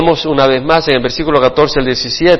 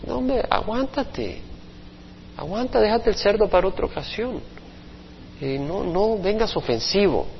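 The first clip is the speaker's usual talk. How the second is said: hushed warning; 3 semitones higher, 11 dB quieter than usual. Acted. Friend urges restraint quietly.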